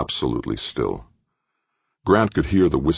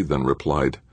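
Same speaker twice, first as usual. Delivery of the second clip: usual speaking voice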